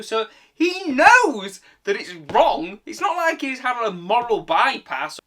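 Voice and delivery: loud, high, emphatic exasperation; stretched, high indignant emphasis; indignant outburst. Real, not acted. Indignant rant about the teacher.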